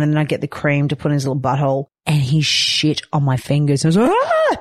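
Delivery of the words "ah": very high short shriek; sudden high-pitched yelp; startled shriek. Retold or imitated. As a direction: yelp in disgust. Baby poops on her fingers.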